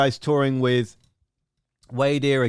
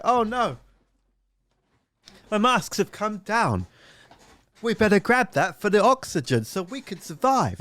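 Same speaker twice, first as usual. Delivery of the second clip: lightly voiced passengers, higher; panicked passengers; 6 semitones higher than usual. Passengers grabbing oxygen masks, mock panic, higher. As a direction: play the worried passengers. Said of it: "people in the back are like".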